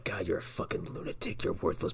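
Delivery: very soft, low, almost whispered imitation; under-breath insult. Dad calls her a lunatic, mock menacing under his breath. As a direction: imitate a dad's quiet, precise put-down. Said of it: "Dad's is far more direct and precise".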